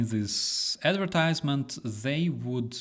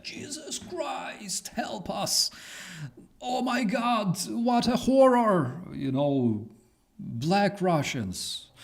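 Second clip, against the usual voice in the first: hammed-up terror, much higher, a shrill mock prayer; mock panicked horror; 9 semitones higher, slower than usual. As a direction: play a scandalised Russian clutching pearls, higher. Mocking Russians' fear of diversity.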